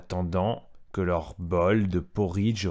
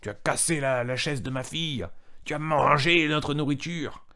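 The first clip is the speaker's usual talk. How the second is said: not happy papa bear; 6 semitones higher than usual. Acted. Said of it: "il est pas content".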